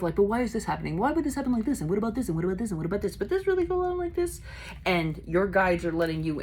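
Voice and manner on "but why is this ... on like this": imitating insistent questioning, jumping high at the end; lightly acted pestering; mild nagging questioning. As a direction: pile the questions up, pitch lifting in places. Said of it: "There is this little bit of".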